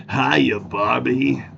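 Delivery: very slow, crooning mock seductive voice; Ken's sexy man voice. Greeting Barbie as Ken, very slow hammed-up crooner. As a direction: stretch it out like a cheesy lover. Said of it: "how Ken opens up".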